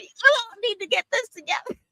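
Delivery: high-pitched mock indignation; Dan Rather hot. Host mimics newsman scolding the network, high mock anger. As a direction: imitate an irate scolding in a squeaky pitch. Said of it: "Dan Rather was hot".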